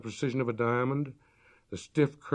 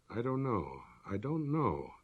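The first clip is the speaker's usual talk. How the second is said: quiet worried; 7 dB quieter, slower than usual. Acted.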